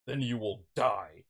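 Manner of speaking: slow, low mock villain menace; acted villain threat. Vader answering Ahsoka in their duel, slow acted menace.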